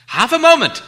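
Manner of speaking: high, loud frightened blurt; frightened Bilbo. Acted. Bilbo stalling the hungry Gollum, frightened and loud. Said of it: "said Bilbo, shivering".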